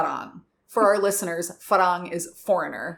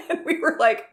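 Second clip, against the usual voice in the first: usual speaking voice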